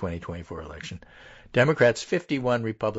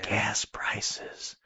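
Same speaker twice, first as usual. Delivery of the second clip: soft, breath-only whisper; whispered aside; 3 dB quieter than usual. Pretend. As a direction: whisper it like a prompt.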